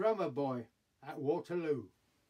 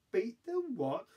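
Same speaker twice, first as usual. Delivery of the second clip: high-pitched, baffled question; incredulous query; 6 semitones higher than usual. Acted. Drummer boy answering Bonaparte's order, incredulous. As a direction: question the order in comic disbelief.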